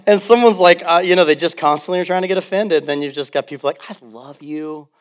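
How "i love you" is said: much softer, tender mock voice. Pastor imitates a loving churchgoer, very soft and tender. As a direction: breathe it gently, full of love.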